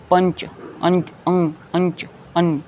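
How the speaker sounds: "पंच" slowly with a clear n; "पंच" sounded like panch; anusvara as n. Shown as a demonstration. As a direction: draw out the n in "अंच". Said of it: "ये अन की आवाज़ निकल रही है".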